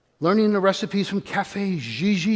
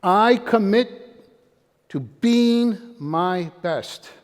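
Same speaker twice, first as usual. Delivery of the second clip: "I commit to being my best" said very slowly, word by word; slow deliberate pledge; much slower than usual. Shown as a demonstration. Speaker giving the audience his golden gem, slow and deliberate. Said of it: "here's my golden gem".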